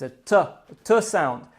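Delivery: "T" sounded as a short tuh; the letter "T" sounded, not named. Shown as a demonstration. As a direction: say "T" as the bare consonant, tuh. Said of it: "then is this sound".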